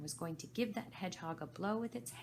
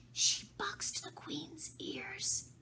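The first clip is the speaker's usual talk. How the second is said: unvoiced, slow gossiping whisper; the Rabbit whispers the scandal to Alice; much slower than usual. A narrator's reading. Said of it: "the Rabbit whispered".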